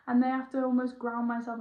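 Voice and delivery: ordinary talk, nothing marked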